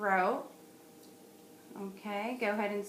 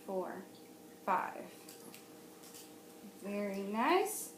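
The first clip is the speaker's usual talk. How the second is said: ordinary delivery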